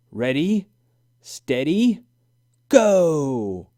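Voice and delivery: rising starter's call, loud on go. A narrator's reading. Judge Ellie starting the ski race, rising loud call. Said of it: "In a loud voice, she called out".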